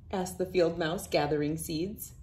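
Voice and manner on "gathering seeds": usual speaking voice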